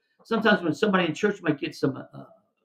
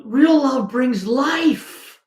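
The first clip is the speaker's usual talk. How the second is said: higher and very slow, each word drawn out; preaching emphasis; 10 semitones higher, 5 dB louder, much slower than usual. Real, not acted. Pastor declaring to the congregation, slow and lifted. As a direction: proclaim it like a banner. Preacher proclaims after the counseling story.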